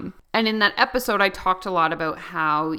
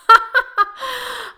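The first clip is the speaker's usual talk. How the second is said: a sudden high, loud laugh; high burst of laughter; 9 dB louder than usual. Real, not acted. Laughing at her own rambling to listeners, high laugh.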